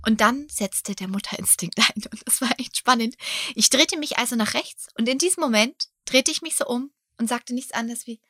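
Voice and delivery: ordinary talk, nothing marked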